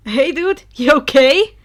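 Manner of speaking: loud nervous Denki voice; worried friend. Acted. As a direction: call out to a frozen friend, unsure. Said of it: "Denki asked Izuku".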